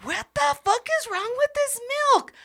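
tugging at something that won't budge; baffled outburst. Retold or imitated. Pulling milk in a dream.

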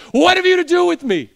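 very high, loud shriek; demon's loud cry. Acted. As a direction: scream it out as a demon confronting Jesus. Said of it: "crying out with a loud voice".

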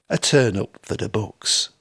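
ordinary delivery

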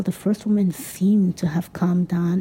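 usual speaking voice

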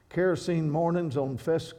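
normal talking voice

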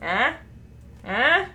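lightly voiced inquisitive woodpecker; woodpecker impression. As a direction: play a prodding little woodpecker, lightly. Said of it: "this woodpecker at the end going".

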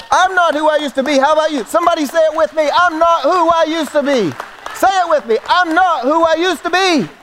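high-pitched, fervent rallying cry; rallying preacher. Real, not acted. Preacher urging the congregation to say it with him, fervent. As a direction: proclaim it high and call them in.

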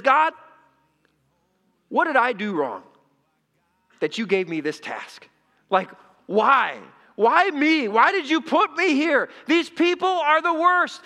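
loud, high, exasperated plea; building to an aggrieved shout; snapping at God. Acted. Moses demanding to know why God chose him, snapping. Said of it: "he just snaps and he starts telling God".

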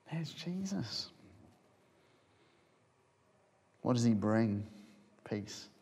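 soft, low, near-whispered awe; hushed reverence. Acted. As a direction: let each word fall into silence. Jesus appears, hushed reverence.

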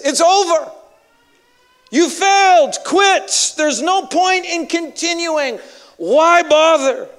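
harsh discouraging shout. Acted. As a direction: shout him down like a voice of defeat.

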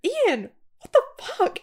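very high, slow drawn-out reaction; mock outraged shriek. Co-host as Fiona walking in on surgery, mock outrage. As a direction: squeal it in exaggerated disbelief. Acting out shocked sister's reaction.

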